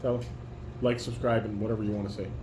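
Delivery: ordinary delivery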